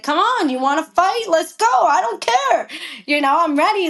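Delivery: high-pitched retold fight challenge; defiant dare. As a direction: square up to him as you retell it. Went after him, challenging to fight.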